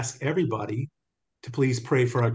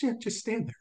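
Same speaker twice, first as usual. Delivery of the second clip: light, higher, softer casual reply; quoting Jesus; 10 semitones higher, 7 dB quieter than usual. Retold or imitated. Jesus telling the speaker to stand there, light and easy. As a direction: toss it off gently. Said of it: "He goes".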